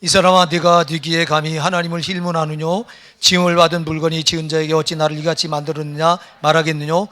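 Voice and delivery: booming unison reading of the verse; loud scripture reading. Shown as a demonstration. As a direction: read it out together, loud and strong. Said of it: "시작". (큰 소리로 함께 성경 봉독)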